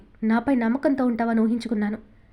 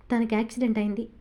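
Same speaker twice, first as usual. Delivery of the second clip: ordinary delivery, nothing marked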